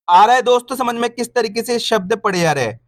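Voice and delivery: usual speaking voice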